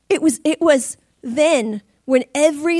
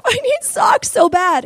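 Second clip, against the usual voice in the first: pleading; 11 semitones higher, 4 dB louder, slower than usual. Retold or imitated. Pleading to husband.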